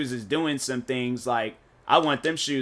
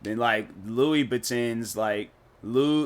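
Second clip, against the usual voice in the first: ordinary talk, nothing marked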